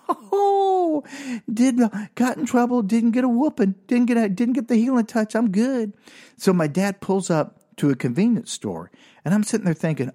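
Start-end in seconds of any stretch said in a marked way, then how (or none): 0.1-5.9 high, jubilant singsong relief; victory-lap gloating. Retold or imitated. Boy celebrates escaping a whooping, high and triumphant. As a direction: celebrate in a high voice like you got away with it. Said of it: "it was like a victory lap".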